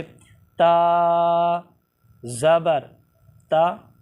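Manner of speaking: each letter name drawn out slowly, one by one; spelling out letters. Shown as a demonstration. Teacher spelling out ता ज़बर ता for students. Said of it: "ये मैंने हिजे किए हैं".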